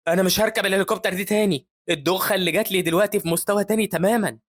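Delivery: high, loud fed-up protest; worked-up grumbling after a dizzying trip; dizzy complaint. A narrator's reading. Dizzy hero complains after helicopter ride.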